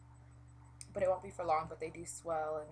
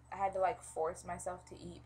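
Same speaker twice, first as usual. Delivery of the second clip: normal talking voice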